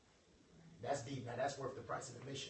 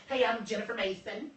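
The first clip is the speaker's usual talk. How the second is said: higher and louder, a bold self-introduction; confident introduction; 6 semitones higher, 7 dB louder than usual. Retold or imitated. Jennifer introducing herself boldly to a stranger. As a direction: sell yourself in one line. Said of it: "when she come up".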